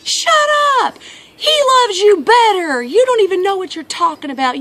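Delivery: put-on very high, loud kid voice; high-pitched sibling comeback; little brother yelling. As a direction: imitate a small boy hollering at his brother. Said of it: "the little brother say something".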